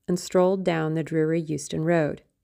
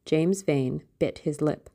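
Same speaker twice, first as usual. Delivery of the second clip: ordinary delivery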